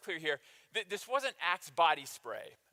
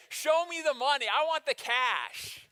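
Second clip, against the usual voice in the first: loud, higher, slowing on the cash; greedy shout; 6 semitones higher, 6 dB louder than usual. Retold or imitated. Congregation member shouting for the cash. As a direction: belt it like a movie line. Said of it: "How many people say".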